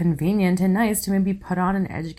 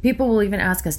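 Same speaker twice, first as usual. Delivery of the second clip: ordinary talk, nothing marked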